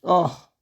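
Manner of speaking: sharp high yelp; startled cry. Real, not acted. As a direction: yelp in shock. Sudden game event.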